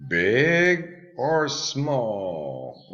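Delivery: big or small drawn out very slowly; very slow teaching drawl. Shown as a demonstration. Teacher modelling the choice for pupils, very slow and drawn out. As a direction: stretch each word for the class.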